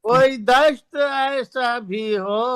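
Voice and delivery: stately nazm recitation. Acted. Reciting sher at mushaira.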